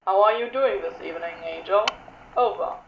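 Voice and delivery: smooth drawling radio voice; Crowley voice. Acted.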